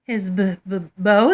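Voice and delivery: caught-out stutter, pitch rising; stammering surprise. A narrator's reading. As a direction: stumble over the word in panic. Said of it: "they stammered in surprise".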